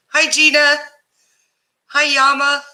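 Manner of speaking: usual speaking voice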